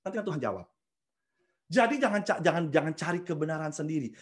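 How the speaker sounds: ordinary talk, nothing marked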